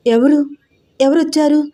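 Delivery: a blind woman sensing an intruder, calling out alarmed; alarmed questioning. Acted. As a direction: ask out in sudden alarm. Blind sister senses a stranger.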